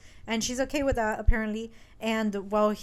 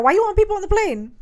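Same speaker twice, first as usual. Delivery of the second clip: high, loud challenge; mock indignation; 9 semitones higher, 9 dB louder than usual. Arguing over the empty plane, mock indignation. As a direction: throw the question back, hammed up. Boyfriend argues back, acted.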